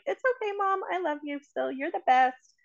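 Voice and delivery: lightly voiced child brushing it off; imitating kids. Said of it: "my kids are like".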